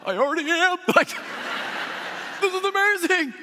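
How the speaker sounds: high, loud laughing joy; laughing delight. Retold or imitated. Answering the bus driver, laughing high and loud.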